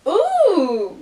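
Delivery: high, loud thrilled ooh; delighted ooh. Real, not acted. Unboxing a gift, she coos at the next book. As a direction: coo over the book you just pulled out.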